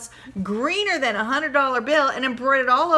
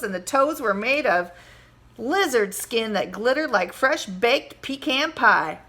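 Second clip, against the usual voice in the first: ordinary delivery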